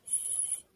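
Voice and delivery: the letter "S" as a long unvoiced hiss; snake-like S sound. Shown as a demonstration. Teacher models the first sound for pupils, snake-like hiss on "S". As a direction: say "S" as one drawn-out sss, like a snake. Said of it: "like snake".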